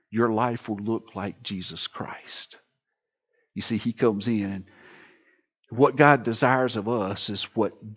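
hushed tender sermon; soft intimate preaching. Acted. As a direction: draw them in gently. Pastor turns soft and intimate.

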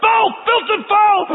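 high, loud, slow spat-out curse; mock cursing. Fisherman's final cuss before the apology, high loud and slow. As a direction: spit out the last curse. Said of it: "he takes a breath".

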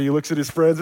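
ordinary delivery

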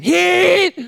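loud high yell of a name; yelling for a friend while hunched in pain. Retold or imitated. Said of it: "yelling Heath's name".

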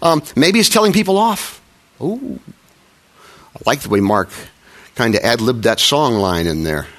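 ordinary talk

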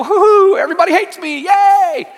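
giddy mock celebration; silly cheering. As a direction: play the cheering fool who misses the point. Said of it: "you stand in your living room and go".

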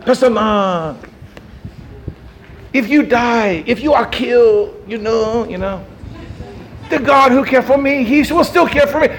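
loose Spanish-sounding accent imitating a pastor friend; half-hearted Spanish lilt in a pastor's voice; lightly accented Spanish imitation; Spanish-ish accent. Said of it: "I've gone Spanish or something in my Pastor Nang imitation".

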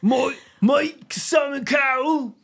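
high, slow, stretched mock-British voice; hammed-up, way-off celebrity imitation; bad Simon Cowell. Co-host mocking a TV judge, bad impression. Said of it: "That's not his voice at all".

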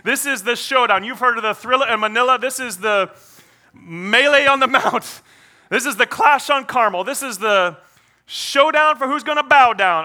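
loud, high-pitched showdown hype; hammed-up ringside announcer billing the big bout; mock fight announcer. Mock fight hype before "I'm done.

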